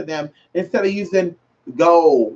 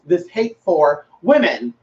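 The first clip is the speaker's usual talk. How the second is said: ordinary talk, nothing marked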